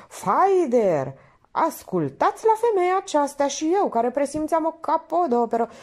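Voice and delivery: painter's high, loud mock outrage, arms to the heavens; hammed-up wounded artist, pitched high and loud; theatrical indignation. Painter protests to the lady that his masterpiece is underpriced, hammed-up.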